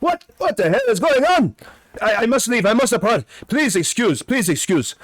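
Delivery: high, hurried, flustered voice; an alarmed wizard excusing himself; fussy startled old man fleeing; panicked wizard. Acted. Turan panicking, fleeing to stairs.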